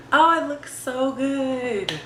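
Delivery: slow, drawn-out gushing; excited. Real, not acted. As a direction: savour each word in delight. Admiring new camera.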